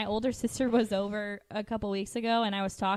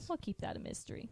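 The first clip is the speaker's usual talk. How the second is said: hushed conspiratorial whisper, much softer; 16 dB quieter than usual. Real, not acted. Teasing the hosts about her accent, a soft secretive whisper. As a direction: whisper it like a secret you won't share.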